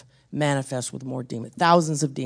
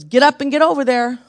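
commanding call; 8 semitones higher, 6 dB louder than usual. Retold or imitated. Lord's command in the night.

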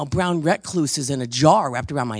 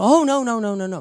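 high, loud mock refusal; hammed-up denial; mock protest; 6 semitones higher, 7 dB louder than usual. Person refusing to give up their illness. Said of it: "or, you know".

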